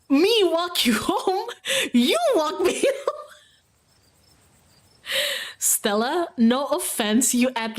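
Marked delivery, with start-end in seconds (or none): 0.1-3.3 high, slow incredulous laugh-talk; can't believe the question, laughing it off; laughing disbelief. Real, not acted. Streamer mocking the game's offer, laughing in disbelief.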